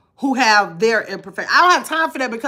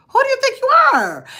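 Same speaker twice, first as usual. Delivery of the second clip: squeaky high, offended who do you think you are; high-pitched mock outrage at the gossipers; mock indignant; 12 semitones higher, 3 dB louder than usual. Shown as a demonstration. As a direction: play it like a scandalised diva asking who do you think you are. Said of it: "That's what I'm looking like".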